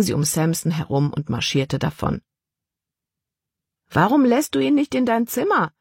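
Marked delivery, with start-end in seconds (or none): 3.9-5.7 higher call after someone walking away; mild call down the hall; calling after her. A narrator's reading. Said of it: "rief Caleb ihr nach".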